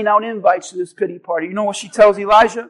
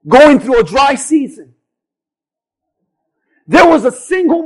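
raised, driving pulpit delivery; impassioned sermon proclamation; full-force preaching; 4 semitones higher, 3 dB louder than usual. Real, not acted. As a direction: preach it out, leaning hard on key words.

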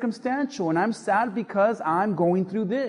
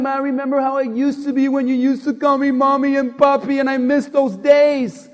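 wistful parent; 4 semitones higher, 6 dB louder than usual. Retold or imitated. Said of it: "and you're like".

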